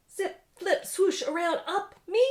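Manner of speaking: put-on high, loud squeals of toddler glee; excited little kid. Little Nika grabbing at the machine, high excited squeals. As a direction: bounce through the words like an eager toddler.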